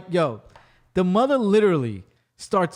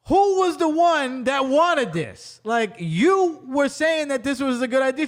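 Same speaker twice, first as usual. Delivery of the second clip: higher, slow, pointed question; exasperated husband; 7 semitones higher, slower than usual. Retold or imitated. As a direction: throw it back at her, drawn out. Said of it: "he's like".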